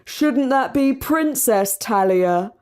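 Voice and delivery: slow, teasing call from the crowd; a wag heckling, lightly voiced; mild mock heckle; mocking heckler. A narrator's reading. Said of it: "Some wag called out".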